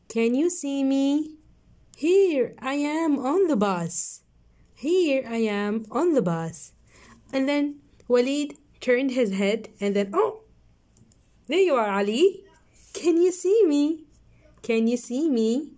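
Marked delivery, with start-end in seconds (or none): 0.1-6.7 put-on reading to children. Reading the story aloud to children. 7.3-12.4 put-on reading to children. Narrating the story to children. 12.9-14.0 lifted, bright question; high excited question. Shown as a demonstration. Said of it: "One more time, let's read".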